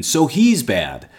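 mock Pharisee verdict. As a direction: drawl it out like a smug accuser.

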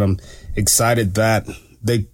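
normal talking voice

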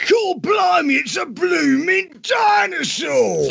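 high, shouted mock Cockney outburst; Cockney accent. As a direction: yell it like a startled Cockney. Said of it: "They could have used you, too, for the key".

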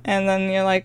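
ordinary delivery

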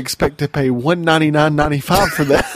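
laughing through a fast mock pitch; fast and loud, fighting laughter. Laughing mock price guess.

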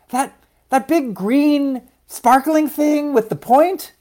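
slow, high, wondering question; eager little seed. A narrator's reading. Louise eagerly recalls the mountain.

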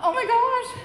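high, loud laughing exclamation; thrilled, laughing gasp; laughing excitement. Retold or imitated. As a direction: gush with a laugh.

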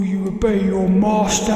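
a hint of a demon master. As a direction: loom over the listener, slowly. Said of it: "like a demon talking".

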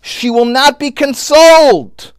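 high, loud, slow declaration; fervent, drawn-out preaching; anguished refusal. Retold or imitated. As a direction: cry it out slowly at full voice. Climax, mother refusing all consolation.

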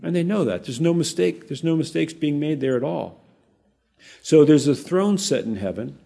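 usual speaking voice

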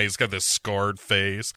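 ordinary talk

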